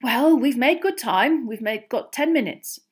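lightly voiced father catching his breath; relieved announcement. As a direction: report progress with relief. Winded father announces good progress.

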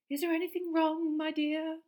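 dreamy professor. A narrator's reading. Dotty professor voiced.

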